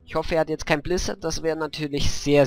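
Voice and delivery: ordinary talk, nothing marked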